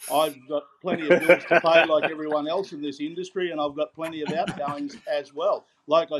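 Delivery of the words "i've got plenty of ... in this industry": chuckling through the words; laughing. Real, not acted. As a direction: say it while laughing. Laughs off the donation question.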